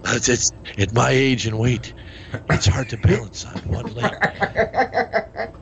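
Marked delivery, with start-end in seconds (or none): 2.5-5.5 slow, high, breaking into laughter; laughing. Real, not acted. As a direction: laugh through the words. Balancing on one leg, laughing.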